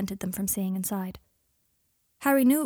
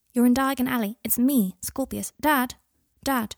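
put-on raised, higher child's voice; calling for attention; 4 semitones higher, 5 dB louder than usual. A narrator's reading. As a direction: call Dad back from his thoughts. Boy calls distracted father back.

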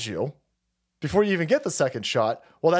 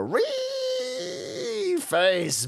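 drawn-out rally cry. Pretend. Streamer rallying his army, drawn out slow and high.

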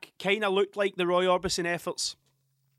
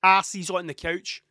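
stern order; 2 semitones higher, 3 dB louder, slower than usual. Retold or imitated. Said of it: "And I was like".

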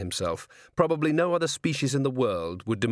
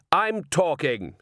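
angry priest; 6 semitones higher, 3 dB louder, slower than usual. A narrator's reading.

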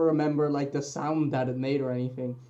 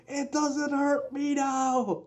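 high, slow triumphant yelp; 9 semitones higher, 4 dB quieter, much slower than usual. Retold or imitated. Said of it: "I yelped that at his face".

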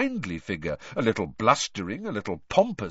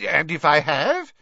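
higher-pitched, defiant rising challenge; stubborn retort, pitched up; 6 semitones higher, 6 dB louder than usual. A narrator's reading. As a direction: throw it back obstinately. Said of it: "a defiant and obstinate look".

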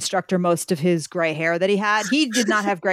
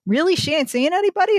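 pitched way up, incredulous; surprised instructor; 9 semitones higher than usual. Retold or imitated. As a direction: disbelief that she has no boyfriend. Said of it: "He was like".

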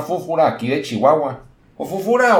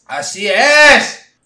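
higher, louder, very slow and smug; smug confirmation; 6 semitones higher, 6 dB louder, much slower than usual. Acted. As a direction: drag it out with cocky pride.

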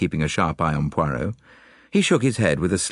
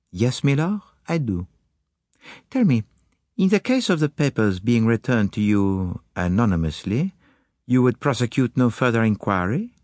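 put-on higher, accented detective voice; Poirot; 6 semitones higher than usual. Detective confiding little ideas to Hastings, higher accented voice. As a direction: play the musing detective, pitched up. Poirot's dialogue in narrated story.

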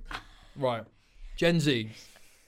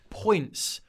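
ordinary talk

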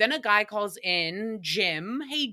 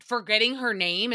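normal talking voice

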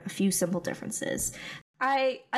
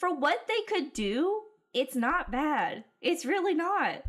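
higher, slower, insisting; animated defense; 7 semitones higher, slower than usual. Real, not acted. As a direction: insist it's not bad, pitched up.

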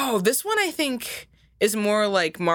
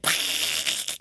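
failed Donald Duck squawk; 4 dB quieter than usual. Shown as a demonstration. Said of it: "can never figure out how to do Donald's voice".

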